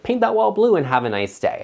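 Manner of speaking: airy, sing-song brush-off pitched high; mock breezy dismissal. Host mocking a lazy designer's advice, breezy and high. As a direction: toss it off like it's nothing. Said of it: "than I would just to say, like".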